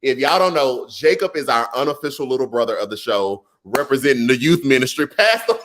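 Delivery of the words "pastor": ordinary delivery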